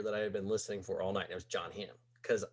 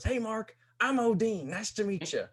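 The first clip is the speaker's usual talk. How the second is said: put-on much higher, sloppy drunk stranger; drunk guy voice; 12 semitones higher, 5 dB louder than usual. Wasted stranger complimenting narrator's hat, high drunk voice. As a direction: play the wasted guy at the bar. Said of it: "And he goes".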